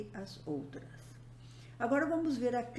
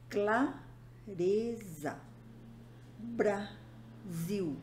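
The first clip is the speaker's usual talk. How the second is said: slow, split into syllables with gaps; much slower than usual. Shown as a demonstration. Teacher showing inseparable consonant clusters, syllable by syllable. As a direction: sound out each word in separated syllables. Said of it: "a regra da soletração".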